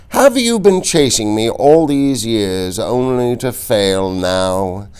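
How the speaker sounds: high, slow, sneering delight; mocking count. A narrator's reading. The Count mocking the wounded Inigo, slow and high. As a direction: savour every cruel word.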